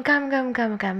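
ordinary talk, nothing marked

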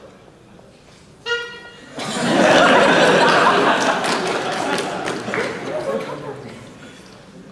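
long, high burst of laughter; loud laughter. Real, not acted. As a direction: crack up loudly at a joke. Laughing at the Rarity impression.

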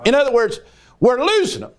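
high, fervent preaching; preaching with fire. Acted. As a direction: build the sermon to a fervent pitch. Preaching at full force.

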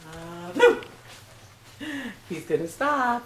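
normal talking voice

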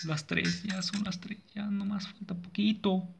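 small, high, soft voice; softly will the night to end; timid, high-pitched hoping; anxious self-reassurance. Real, not acted. Nervously waits for night to end.

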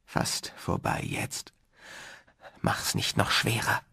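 faint, fading whisper; feeble, slow and hushed; weak voice. A narrator's reading. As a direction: barely get the words out, softly. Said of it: "dann Warrens schwache Stimme".

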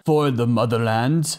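a hammed-up bad Russian accent on the motherland line; terrible Russian accent. Reviewer mocking the scientist's sacrifice, in a bad Russian accent. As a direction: say it like a cartoon Soviet hero, badly faked Russian accent. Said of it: "That was a terrible Russian accent".

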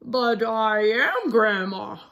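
slow put-on granny voice; wolf faking grandma. Wolf caught by Red, slow fake granny voice. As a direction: play innocent as grandma.

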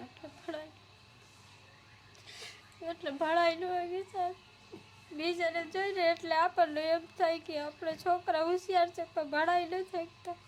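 words pushed out through real sobs; crying. Real, not acted. Widowed mother tells interviewer she can't afford school, crying.

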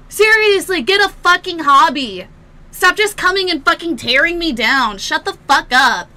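furious shouted rant; angry yelling. Real, not acted. Streamer raging at a hater in chat. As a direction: keep yelling at him.

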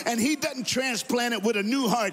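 preaching at full force. Acted. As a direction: lift it to a roaring preacher's peak. Preaching builds to a climax.

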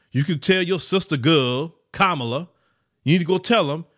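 normal talking voice

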